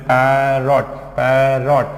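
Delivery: "पैरॉट" drawn out as "paair-rot"; broken into syllables. Shown as a demonstration. Teacher showing where to break the word, "पैरॉट" in two parts. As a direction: stretch the first syllable of "पैरॉट", then "rot". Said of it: "देखो कैसे यहाँ break करना है".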